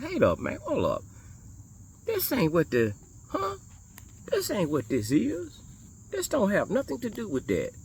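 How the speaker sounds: high-pitched, startled protest at what he reads; incredulous objection. Retold or imitated. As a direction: retell a baffled double take, pitch jumping up. Retold startled objection to teachings.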